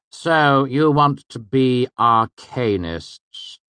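slow, loud, high sneering master; haughty professor scorning the class; Master Hemme. A narrator's reading. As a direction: lecture down at the new students with contempt. Narrator voices Master Hemme.

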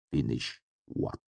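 low, soft trailing question; cool, trailing away. A narrator's reading. Haliax turning to Cinder, cool voice trailing away. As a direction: let it drop and fade off. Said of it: "His cool voice trailed away".

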